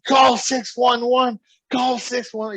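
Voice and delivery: high-pitched panicked call for help; imitated frantic shout. Booker wanting the ambulance, imitated frantic yelling. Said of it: "Luke is going crazy".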